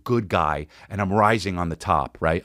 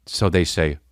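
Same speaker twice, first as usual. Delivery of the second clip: normal talking voice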